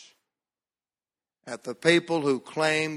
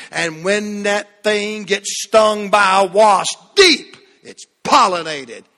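loud, slow building sermon climax; full-force preaching; 5 semitones higher, 9 dB louder, slower than usual. Acted. Preacher driving the point home to the church, loud and slow. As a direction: drive it home to the congregation.

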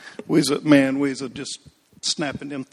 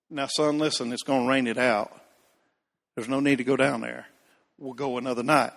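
calm dad; 3 semitones lower, 8 dB quieter than usual. Retold or imitated. Dad calming disappointed son.

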